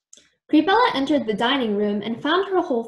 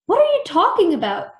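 loud, high burst of disbelief; startled, raised-voice question; shocked exclamation; 7 semitones higher, 6 dB louder than usual. A narrator's reading. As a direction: blurt it out in shock. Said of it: "exclaimed a shocked Billy".

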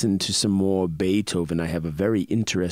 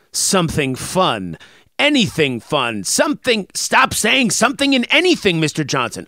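mock exasperation; 6 semitones higher, 5 dB louder than usual. Mock argument with himself.

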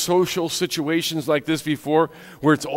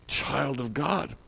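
soft echo; 12 dB quieter, much slower than usual. Acted. Preacher repeats line softly.

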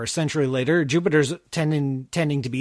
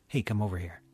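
low, soft creepy beckoning; creepy text; 6 semitones lower, 8 dB quieter, faster than usual. Pretend. Jupiter texting Earth every two years, creepy murmur. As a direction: make the invite faintly creepy. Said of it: "It's a little creepy".

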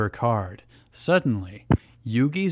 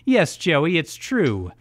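higher, hammed-up villain monologue; cackling villainess bragging; gloating villain; 7 semitones higher, 4 dB louder than usual. A narrator's reading. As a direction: gloat theatrically over the beaten rival. Villain gloats after laughing.

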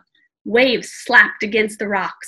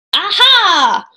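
loud, high, jolly boom; monk booming at the boy climbing over the edge; booming jolly aha; 9 semitones higher, 7 dB louder than usual. Acted. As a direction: catch the intruder with glee. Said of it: "boomed a deep, jolly voice".

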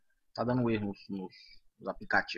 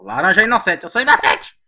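raised, urgent shout climbing in pitch; loud protest; 10 dB louder, faster than usual. Real, not acted. Accused player insisting on being innocent, urgent shout. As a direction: shout it out, pleading your case.

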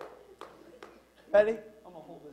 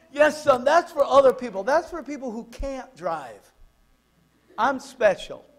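mock self-justifying father; 5 semitones higher, 5 dB louder than usual. Father justifying speeding to son, acted.

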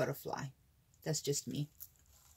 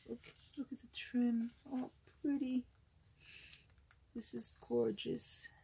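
ordinary talk, nothing marked

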